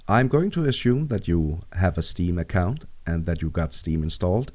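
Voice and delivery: usual speaking voice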